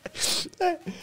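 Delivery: breathless high wheezing laugh; silent wheeze. Real, not acted. Streamer wheezing at the furry rumor. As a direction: laugh with no voice left.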